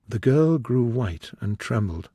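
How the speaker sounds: ordinary delivery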